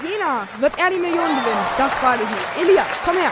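fast, pumped-up quiz show announcer; game show host. Pretend. Host introducing Elias to the audience, fast hyped announcer. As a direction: hype the new contestant like a TV host.

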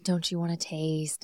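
low, soft mock taunt; imitated taunting. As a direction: imitate a vampire quietly teasing her prey. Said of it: "Darla's taunting him".

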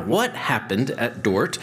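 ordinary delivery

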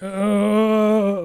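high strained groan; pained groan. Retold or imitated. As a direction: groan like the dentist is hurting you. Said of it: "obviously I'm in some kind of a pain".

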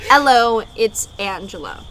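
loud "Hello" with no H, as 'ello; HELLO without the H. Shown as a demonstration. Repeating the name for the microphone, "Hello" said as 'ello. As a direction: drop the H in "Hello", punch it, then ease off. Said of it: "but without the H".

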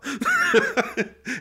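real laugh breaking out high; burst of laughter. Real, not acted. Retelling the surprise call, he bursts out laughing. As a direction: crack up at the memory.

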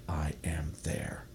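slow whisper of God's voice; hushed whisper. Pretend. Minister voicing God to the congregation in a slow whisper. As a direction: breathe it out slowly in a whisper. Love's words, spoken in a whisper.

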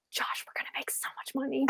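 hushed greedy whisper; a mother whispering to her husband about money; scheming whisper. Retold or imitated. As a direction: breathe the money plan. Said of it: "she's like".

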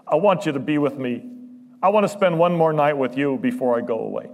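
high, loud pleading quote; impassioned preacher voicing Jesus; preaching force. Voicing Jesus' tender plea, loud.

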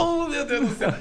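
high, hammed-up gasp of awe; mock amazement. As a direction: gush in exaggerated wonder.

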